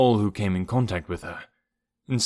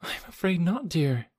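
soft, higher-pitched, sorrowful reply; sadly; 8 semitones higher, 4 dB quieter than usual. A narrator's reading. Hazel confirming the death to her friend, sad and tender. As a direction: break it gently, with regret. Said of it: "answered Hazel sadly".

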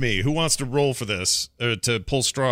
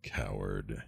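cursing under breath; 14 dB quieter than usual. Acted. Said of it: "That's what I say under my breath".

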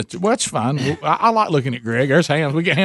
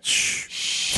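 shushing; 8 dB quieter than usual. Real, not acted. Hushing near sleeping kids.

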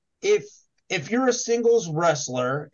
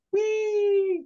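high squeal. Pretend. Mimics zooming around the states.

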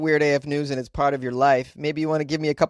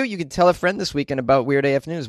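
ordinary talk

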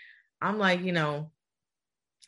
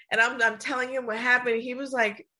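normal talking voice

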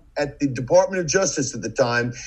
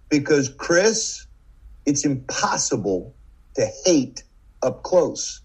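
dramatic pause; slower than usual. Retold or imitated. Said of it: "a dramatic pause".

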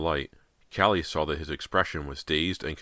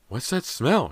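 high, louder demanding question; 10 semitones higher, 5 dB louder, slower than usual. A narrator's reading. Mother to her daughter about the smell, demanding. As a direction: demand an answer, pitched high. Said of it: "she demanded".

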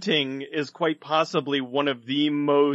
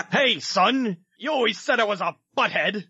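movie character voice; 6 semitones higher than usual. Retold or imitated. Voicing a character's line.

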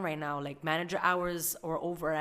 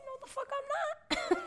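defiant refusal; 5 dB quieter than usual. Retold or imitated. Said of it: "I said".

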